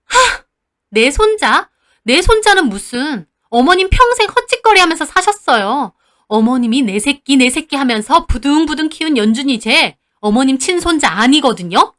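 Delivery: scoffing high, loud outburst; furious outburst. Acted. Mother shouts the family secret at grandma, furious. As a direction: explode with a bitter scoff. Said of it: "소리를 지르셨어요".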